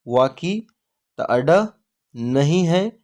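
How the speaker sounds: usual speaking voice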